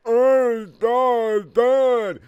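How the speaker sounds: very high, loud, slow hammed-up sob; crying. A narrator's reading. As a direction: wail it like an overdone teen. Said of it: "said Amy in a crying way".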